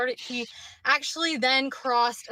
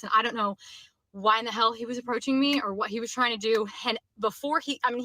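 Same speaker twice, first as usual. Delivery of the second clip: ordinary talk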